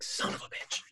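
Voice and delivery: whispered, resigned curse; hushed mock cursing. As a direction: swear under your breath in acted disbelief. Acts sitting there realizing it.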